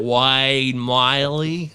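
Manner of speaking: very slow, drawn-out Aussie vowels; lightly mock Australian; mock Australian accent. Shown as a demonstration. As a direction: drag the name out in a jokey Australian accent. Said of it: "I sound like I'm Australian".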